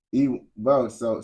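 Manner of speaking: ordinary talk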